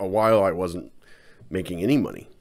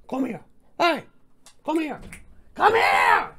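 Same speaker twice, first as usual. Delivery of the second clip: calling the dog. Real, not acted. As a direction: coax the dog over in a high pet voice that swells.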